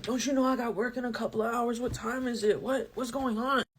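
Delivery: lightly voiced drowsy, confused mumble; groggy boyfriend. Boyfriend woken at night, lightly voiced groggy confusion. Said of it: "He was like".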